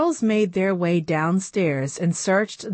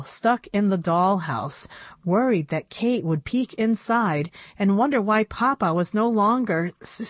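ordinary delivery

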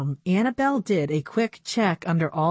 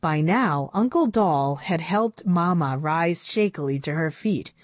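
ordinary delivery

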